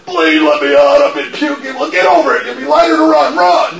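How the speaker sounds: high, loud back-and-forth yell; hammed-up shouting at a puker; mock yelling exchange. Acting out bus door shouting.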